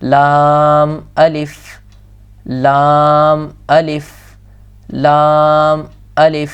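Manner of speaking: drawn-out letter names, each held long; slow recitation. Shown as a demonstration. Teacher reciting Qaida letters slowly for students.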